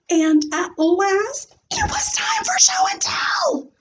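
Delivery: hushed, high, thrilled; breathless excitement. A narrator's reading. Junie B at last reaching show and tell, hushed high thrill. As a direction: whisper it like the best secret ever.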